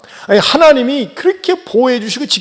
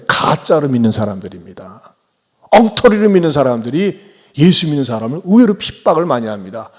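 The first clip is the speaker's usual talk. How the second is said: ordinary delivery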